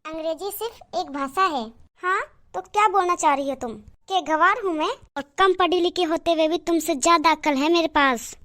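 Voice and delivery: normal talking voice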